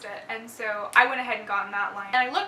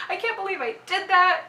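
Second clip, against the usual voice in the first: higher, louder self-reproach; frustrated disbelief; 8 semitones higher, 5 dB louder than usual. Retold or imitated. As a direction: relive being exasperated at yourself. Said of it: "I'm so frustrated".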